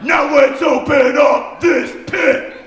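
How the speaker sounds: loud, high, slow hyped preacher; rally the pit like a preacher; mock preacher hype.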